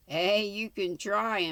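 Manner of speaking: usual speaking voice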